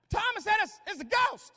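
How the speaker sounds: high, loud yell; shouted report. Acted. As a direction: holler it across. Disciples shouting to Jesus.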